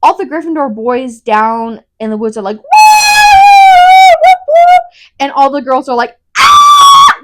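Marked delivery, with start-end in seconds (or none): 2.6-4.8 high, loud mock whooping; imitated cheering. Imitating Gryffindor boys down below, loud whooping. 6.4-7.2 very high, loud imitated shriek; mock freak-out scream. Imitating all the girls freaking out, high loud scream. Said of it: "Like, freaking out".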